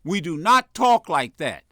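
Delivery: stern party-chairman rebuke, hard on "stop"; firm, no pussyfooting. Acted. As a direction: lay down the law, no hedging. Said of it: "I don't mean pussyfooting over it".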